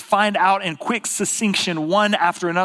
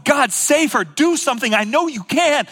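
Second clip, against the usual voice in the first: high-pitched, urgent begging; crying out; 8 semitones higher, 3 dB louder than usual. Acted. Preacher voices a parent begging God to save a daughter, crying out. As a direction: plead at the top of your range. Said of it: "I'm praying and crying out and going".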